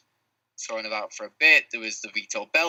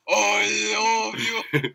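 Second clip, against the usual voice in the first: high, very slow fighter impression; Yoel Romero; 11 semitones higher, much slower than usual. Fighter answering everyone in the queue, drawn-out impression. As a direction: drag it out in a high, exaggerated voice. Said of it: "your amazing Yoel Romero impression".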